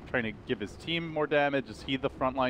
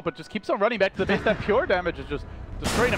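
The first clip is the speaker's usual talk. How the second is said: raised, driving live fight commentary; excited play-by-play; 6 semitones higher than usual. Acted. Caster to viewers during a team fight, excited play-by-play. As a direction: call the team fight at full tilt, pitched up.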